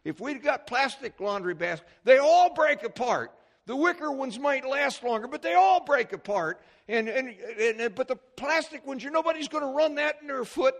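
very loud, high exasperated tirade; ranting complaint. Retold or imitated. Husband giving wife trouble over wicker baskets, loud rant. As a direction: rant at full volume, re-enacting a domestic gripe. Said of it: "giving her all kind of trouble".

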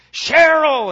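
loud, high drawn-out yell of a name; angry scream. Retold or imitated. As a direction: bellow for your wife across a crowded terminal. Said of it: "he kept yelling".

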